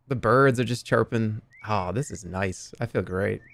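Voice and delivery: serene, unhurried and low; blissfully relaxed. Pretend. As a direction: savor the peace at a leisurely pace. Contrasts own ease with others' panic.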